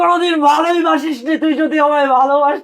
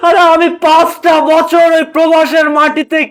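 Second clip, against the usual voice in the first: sobbing out the words, slow and louder; crying; 2 semitones higher, 4 dB louder than usual. Acted. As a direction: weep as you plead about the wasted years. Son breaks down over lost years.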